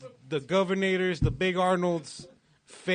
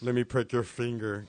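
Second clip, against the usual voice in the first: low put-on founder voice; a lightly played con-artist offer; Elizabeth Holmes impression; 5 semitones lower than usual. Friend plays Elizabeth Holmes asking for blood. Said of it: "is it Elizabeth Holmes?".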